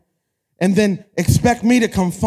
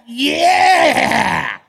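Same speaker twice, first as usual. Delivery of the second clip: long, drawn-out hype yell; 3 semitones higher than usual. Retold or imitated. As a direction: shout it like a party-starting rapper. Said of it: "she's like [name] in diapers".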